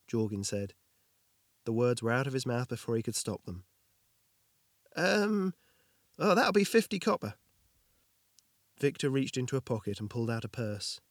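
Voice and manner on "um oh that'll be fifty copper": embarrassed smith. Acted. Jorgin embarrassed, naming price.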